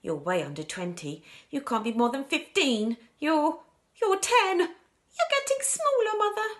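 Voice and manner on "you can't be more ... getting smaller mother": rising into a high, loud panic; mounting alarm. Acted. Daughter watching her mother shrink, mounting alarm. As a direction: build from worry to acted panic.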